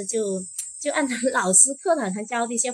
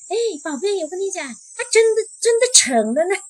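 much higher and louder, bursting with joy; overjoyed; 10 semitones higher, 8 dB louder than usual. Retold or imitated. Husband telling wife he got the job, thrilled and loud. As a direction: shout the good news like you can't contain it. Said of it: "当时他就很开心".

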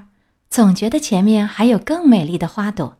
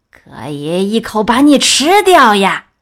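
slow, louder wolf voice; menacing wolf; 6 dB louder than usual. Acted. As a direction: drop the disguise and threaten. Wolf reveals himself. (狼露出本性，慢慢地威胁)

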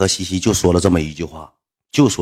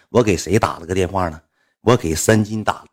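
ordinary talk, nothing marked

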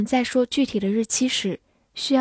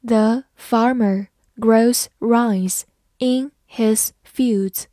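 slow, careful read of The farmer grows rice in his fields; 4 dB louder, much slower than usual. Shown as a demonstration. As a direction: read slowly word by word. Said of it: "好，慢慢来读。". (慢慢地朗读)

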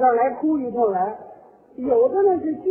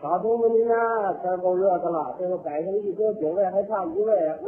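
level, stretched-out banquet call; funeral usher calling; 7 semitones lower, 3 dB quieter than usual. Acted. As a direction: intone the seating call to the mourner. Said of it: "就是那个知客劝".

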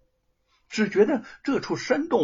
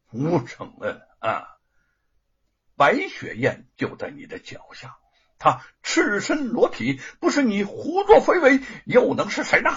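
sharp accusation rising higher and louder; 6 semitones higher, 4 dB louder than usual. Acted. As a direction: jab each word as an accusation with a mocking laugh. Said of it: "厉声地说". (罗万金指着女人厉声指控吴承恩)

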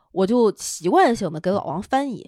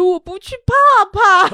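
put-on higher, babyish scared voice; mock scared husband; 10 semitones higher, 9 dB louder than usual. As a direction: whine it like a scared child. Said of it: "王总".

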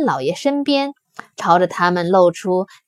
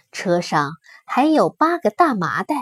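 plain reading voice

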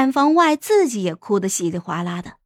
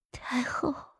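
faint, higher and breaking; weak dying voice; 4 semitones higher, 12 dB quieter than usual. Acted. Dying consort calling the empress dowager, faint and tearful. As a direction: barely get it out, near tears.

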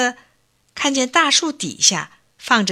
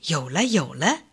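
1 inner thought; 6 semitones lower, 6 dB quieter than usual. A narrator's reading. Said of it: "心里想".

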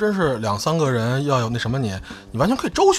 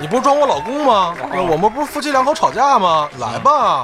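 loud challenge; 7 semitones higher, 4 dB louder than usual. Pretend. Acting out a fake couple quarrel.

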